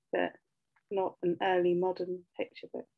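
usual speaking voice

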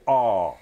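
low, held-out AH; open vowel demo. Shown as a demonstration. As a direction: draw out an open "Ah".

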